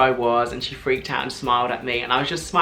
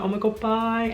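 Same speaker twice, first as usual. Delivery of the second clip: gushing goodbye; 9 semitones higher than usual. Retold or imitated. Star to a fan being pulled away, gushing goodbye. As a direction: imitate a star squealing goodbye without shouting.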